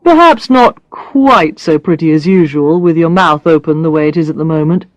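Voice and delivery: lightly voiced man, matter-of-fact teasing; a hint of a blunt man listing faults; Brad's voice. Brad listing Alex's faults, lightly voiced teasing man.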